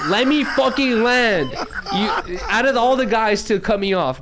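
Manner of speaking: slow, high-pitched exasperation; exasperated protest. Real, not acted. Streamer telling friend to stop cutting him off, exasperated protest. Cut off mid-story by teasing friends.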